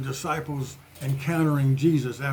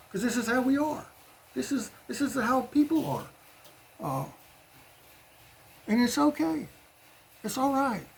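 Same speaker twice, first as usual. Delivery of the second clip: gentle reassurance; 10 semitones higher, 3 dB quieter than usual. Real, not acted. Preacher reassuring congregation.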